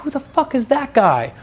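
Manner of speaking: put-on high, drawn-out amazed girls' voice; put-on high voice of wowed girls; imitated impressed girls. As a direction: gush in mock amazement, high and slow. Imagined girls' impressed reaction.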